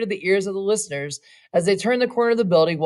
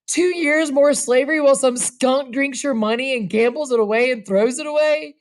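a touch of bitter anger; bitter outcry; 6 semitones higher than usual. Acted. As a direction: rail against it. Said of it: "he cried".

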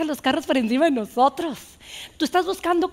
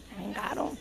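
breathy repeated aside, much softer; near whisper; 6 semitones lower, 15 dB quieter than usual. Real, not acted. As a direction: drop it to a confiding murmur. (repite casi susurrando)

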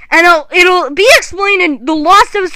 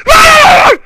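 mock roar; 11 semitones higher, 5 dB louder than usual. Kid roaring at villain.

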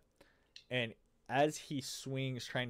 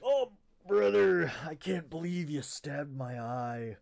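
high, loud, slow dying wail of a wraith; 6 semitones higher, 9 dB louder than usual. Acted. Game master voicing the stabbed Wraith falling, dying wail. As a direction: stretch out the dying wraith's lament.

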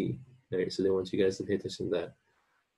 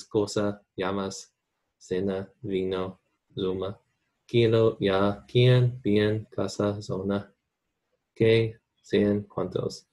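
Latin American accent; slower than usual. Shown as a demonstration. Teacher shows students the word list in a Latin American accent. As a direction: say "cena" and "zona" with an s, Latin American style. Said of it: "I mostly have a Latin accent".

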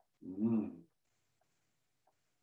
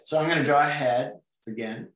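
ordinary talk, nothing marked

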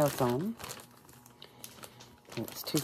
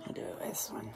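whispering; 4 semitones lower, 6 dB quieter than usual. Real, not acted. Whispers choosing lipstick.

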